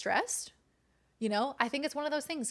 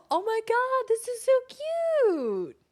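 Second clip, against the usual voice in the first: high, loud gushing squeal; gasp, then squeal with delight; 13 semitones higher, 8 dB louder than usual. Real, not acted. Seeing the scrapbook, delighted high squeal.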